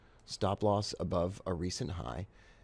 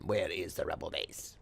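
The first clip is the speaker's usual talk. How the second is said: Star Wars villain quote; 3 semitones lower, 6 dB quieter than usual. Retold or imitated. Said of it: "A little Star Wars quotations".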